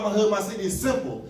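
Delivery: usual speaking voice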